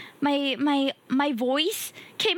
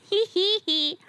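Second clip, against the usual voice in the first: drawn-out hee-hee giggle; playful giggle; 3 semitones higher than usual. Real, not acted. Streamer teasing viewers about being so sweet, playful giggle. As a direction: giggle it out slowly, pleased with the joke.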